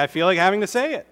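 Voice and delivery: ordinary delivery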